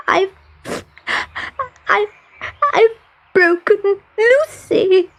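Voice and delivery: put-on high, halting little girl in tears; sobbing. A narrator's reading. Little girl confessing her doll is broken, sobbing. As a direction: sob it out, catching your breath. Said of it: "she sobbed".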